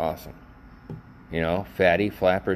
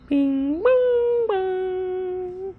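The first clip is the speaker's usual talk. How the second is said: very high sung bing bong bong; chime imitation; much slower than usual. Host marking the time mark with a sung chime. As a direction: ring it like a doorbell chime.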